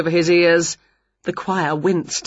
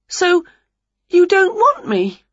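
high, mildly hurt question; rejected witch; 12 semitones higher, 3 dB louder than usual. A narrator's reading. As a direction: ask high, a touch crestfallen. Winnie rejected by conductor.

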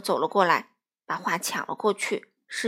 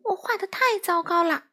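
angry; 9 semitones higher, 4 dB louder than usual. Acted. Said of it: "他生气地从小鸭手里".

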